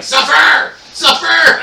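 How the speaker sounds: high, loud locust cry; mock tormenting shout. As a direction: shriek it like a comically menacing locust. Voicing locusts that torment people.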